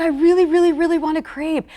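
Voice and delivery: high-pitched mock pleading; pleading sister. Sister wanting a street crepe, high imitated plea. Voicing her sister's craving.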